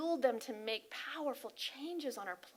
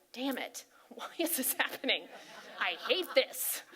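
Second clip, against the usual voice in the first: a hammed-up grumble, louder on hate; mock angry prayer; 4 dB louder than usual. Showing how not to pray, mock angry grumble. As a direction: grumble it like a fed-up complainer. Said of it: "we don't speak our prayers saying".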